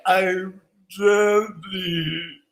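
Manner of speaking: drawn-out, drugged-out slur; imitating someone on downers. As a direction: drag the name out as if sedated. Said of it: "he's loaded on down".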